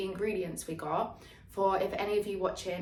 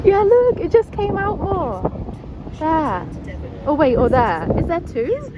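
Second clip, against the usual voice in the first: excited; 9 semitones higher, 11 dB louder than usual. Real, not acted. Spotting a whale jumping.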